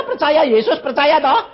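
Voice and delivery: usual speaking voice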